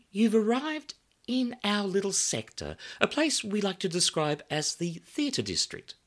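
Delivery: high, bright welcoming hostess; glamorous hostess voice. Acted. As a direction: greet guests like a bombshell hostess. Samantha hostess voice.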